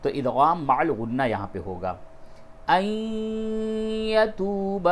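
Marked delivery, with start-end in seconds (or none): 2.7-4.9 ان یتوب merged as one, the nasal n blending into the ya; idgham with ghunna. Shown as a demonstration. Teacher showing idgham on ان یتوب, nasal merge. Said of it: "یہ ادغام کرنے کا طریقہ دیکھیے".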